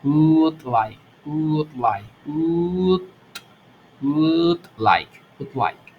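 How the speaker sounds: would said as a long stretched u, no l. Shown as a demonstration. Teacher modelling would like, long u sound. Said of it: "una especie de U alargada".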